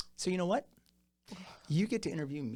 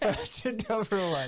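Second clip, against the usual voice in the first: slow, drawn-out quiz host announcement; game show host; 5 semitones higher than usual. Retold or imitated. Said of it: "This isn't a dating game".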